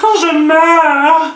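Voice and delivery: high slow laughing groan. Real, not acted. Laughing, cramp pain. (gémissement aigu en riant)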